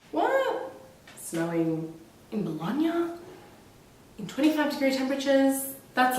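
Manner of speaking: high-pitched mock "what?"; mock disbelief. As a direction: play shocked, pitch leaping up. Feigned disbelief at own claim.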